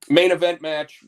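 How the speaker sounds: normal talking voice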